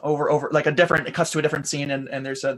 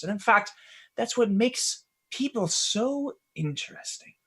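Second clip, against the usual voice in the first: Quell; 4 dB quieter than usual. Acted. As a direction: take your time, lingering on each thought.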